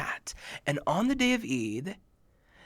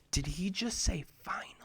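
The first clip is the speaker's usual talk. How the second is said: unvoiced whisper among friends; 9 dB quieter than usual. Boys whispering to each other in the masjid, unvoiced. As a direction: lean in and whisper it. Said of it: "look at each other".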